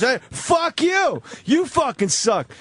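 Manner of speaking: high, slow, drawn-out yell; mock angry yell. A stranger yelling at graffiti in the rest stop, mock yell. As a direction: bellow it at the stall wall.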